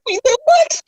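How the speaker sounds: very high, incredulous squeal; mock shocked reaction. Colleagues reacting to the friend's slip, mock shocked. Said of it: "And they're like".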